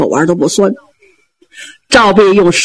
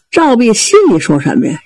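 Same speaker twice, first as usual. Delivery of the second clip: ordinary delivery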